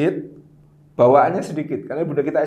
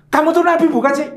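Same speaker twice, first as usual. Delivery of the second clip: high, loud exasperated jab; mock angry jealous wife, much higher and louder; angry jealous retort; 11 semitones higher, 8 dB louder than usual. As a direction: snap at him like a jealous wife. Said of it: "wanita yang sangat marah dan cemburu".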